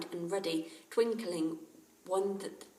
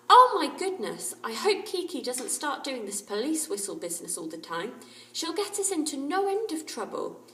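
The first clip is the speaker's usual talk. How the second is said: much higher, louder exasperated cry; dismayed; 7 semitones higher, 9 dB louder than usual. A narrator's reading. A girl worrying about the parrot's whistle, dismayed. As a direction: throw up your hands in dismay.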